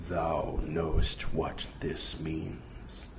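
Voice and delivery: low, slow, hushed oracle; mysterious, drawn-out low question; ominous voice from beyond. Acted. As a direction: intone it softly and very slowly.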